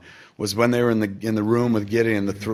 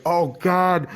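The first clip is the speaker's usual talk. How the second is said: rising higher and higher in ecstatic disbelief; overwhelmed joy; 10 semitones higher than usual. Retold or imitated. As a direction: gush like the moment is too perfect to survive. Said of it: "I was like".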